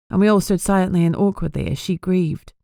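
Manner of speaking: ordinary delivery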